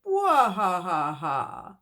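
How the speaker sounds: slow, loud villain cackle; spooky monster laughter; evil laugh. Acted. Mummy's head laughing evilly.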